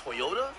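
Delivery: Toyota asked as "Toy-ota"; others' pronunciation. Retold or imitated. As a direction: start Toyota with "toy", as a question. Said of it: "y'all say it?".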